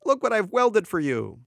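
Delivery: high, proud announcement; excited shout. A narrator's reading. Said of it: "he shouted".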